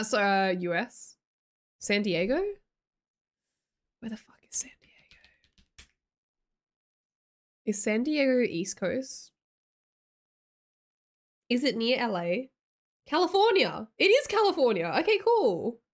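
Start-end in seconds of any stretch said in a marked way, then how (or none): 4.0-5.8 whisper. Real, not acted. Muttering to self while confused. 13.0-15.8 excited. Real, not acted. Realizing the answer, excited.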